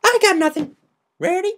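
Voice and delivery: a bubbly pony caught off guard; squeaky party pony, puzzled; Pinkie Pie voice. Acted.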